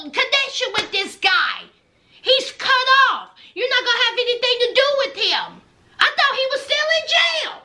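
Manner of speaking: hammed-up jealous rant; mock jealous husband. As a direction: play the outraged husband, fast and high. Husband's jealous outburst voiced.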